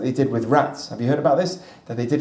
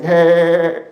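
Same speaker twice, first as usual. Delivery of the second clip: mock cheery hey; 3 semitones higher, 6 dB louder than usual.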